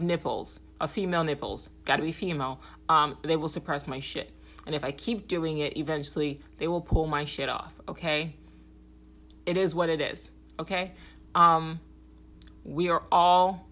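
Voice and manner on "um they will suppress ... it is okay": ordinary talk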